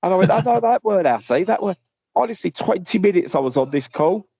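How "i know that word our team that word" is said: teasing. Retold or imitated. Teasing the caller.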